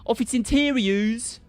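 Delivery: stretched mock Canadian vowels; hammed-up, botched Canadian accent; attempted Canadian accent. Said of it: "a Canadian accent in English".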